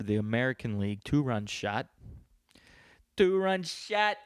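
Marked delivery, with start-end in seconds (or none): 3.2-4.2 high, slow, drawn-out sports call; hyped home-run call. Acted. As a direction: stretch it out like an excited announcer, high-pitched.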